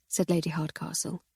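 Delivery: ordinary delivery, nothing marked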